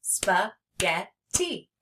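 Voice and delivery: "Spaghetti" as three slow even beats; "Spaghetti" spoken to a clapping beat; clapped-out syllables. Shown as a demonstration. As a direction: chop "Spaghetti" into spa, get, tee. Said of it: "We can even clap them".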